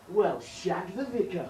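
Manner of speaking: slow, prim posh English oath; posh English swearing. Acted. Eddie surprised at meeting Mickey, in a posh English accent. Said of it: "You swearing that posh voice?".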